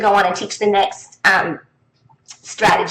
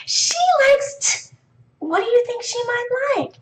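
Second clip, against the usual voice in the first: teacher prompt; 12 semitones higher than usual. Shown as a demonstration. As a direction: stop at the sound and coax. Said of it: "teacher prompting class".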